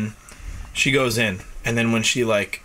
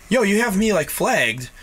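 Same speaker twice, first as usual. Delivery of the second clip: indignant rant; 7 semitones higher than usual. Retold or imitated. Retold rant at border office.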